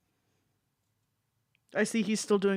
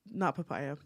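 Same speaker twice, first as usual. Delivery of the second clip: normal talking voice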